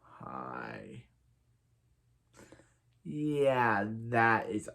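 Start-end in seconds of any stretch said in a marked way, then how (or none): 0.0-1.0 low, very quiet awkward hi; awkward greeting. Retold or imitated. The Earth two doctor meets the team, awkward hi. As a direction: mumble an uncomfortable hello. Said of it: "and it's an awkward".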